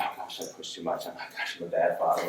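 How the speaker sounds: soft, half-whispered self-reproach, mostly breath; guilty inner murmur. Retold or imitated. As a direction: murmur it to yourself like a father who went too far. Father's inner guilty thought.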